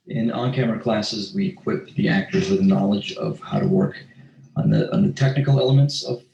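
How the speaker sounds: ordinary delivery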